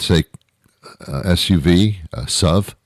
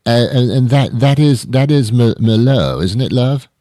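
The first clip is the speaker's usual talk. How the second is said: higher, louder British bloke voice; British accent; 6 semitones higher, 4 dB louder than usual. Acted. British guy asks the barmaid about his Merlot, British accent. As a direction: put on a British drinker checking his order. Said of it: "what if the British guy".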